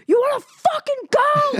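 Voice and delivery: high, loud shrieking dare; crazed challenge. Retold or imitated. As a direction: scream it like you've lost it. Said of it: "I'm just going nuts".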